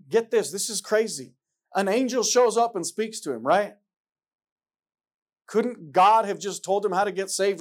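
loud, high fervent preacher build; rising pulpit fervour, close to a shout; preaching at full force. Acted. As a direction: drive it home to the congregation. Preaching climax.

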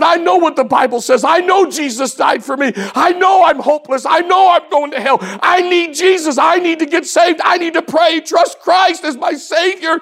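high, loud, urgent pleading; pleading child's outcry. Retold or imitated. Boy at the altar pleads with his pastor, urgent outcry. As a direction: beg like a kid who can't wait.

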